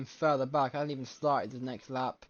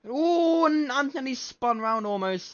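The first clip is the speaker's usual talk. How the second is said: a high, loud gasp of alarm then rushing on; high, loud, startled race call; excited commentary; 9 semitones higher, 4 dB louder, slower than usual. Real, not acted. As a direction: yelp as the car nearly spins. Commentator reacts to a near spin.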